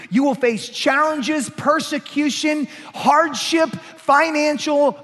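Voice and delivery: high, loud, rising sermon climax; preaching at full force. Acted. Pastor to the congregation, listing trials, preaching at full force. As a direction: pound out each hardship to the congregation.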